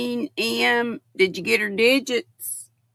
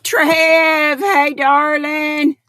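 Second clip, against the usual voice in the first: loud, high hey to Trev; slow, loud greeting to a late viewer; calling out greeting; 7 semitones higher, 9 dB louder, much slower than usual. Real, not acted. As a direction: call out his name with delight.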